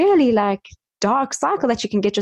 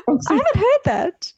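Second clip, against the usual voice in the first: surprised; 11 semitones higher than usual. Real, not acted. Surprised at new word.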